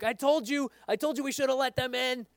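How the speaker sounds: high, told-you-so squabble; disciples' conversation, one blaming another; bickering disciple. Retold or imitated. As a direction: play a petty disciple saying I told you so. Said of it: "I can only imagine their, the disciples' conversation".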